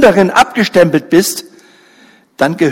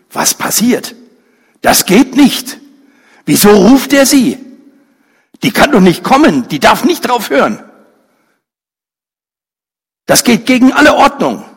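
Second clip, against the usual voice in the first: indignant men; 6 semitones higher than usual. A narrator's reading. Outraged church leaders react.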